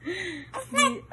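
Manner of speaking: put-on amused mother fussing over the kids; giggly high mommy voice. Mother laughing as toddler squeezes baby, high giggly coo. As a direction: laugh through it, pitched high and cooing.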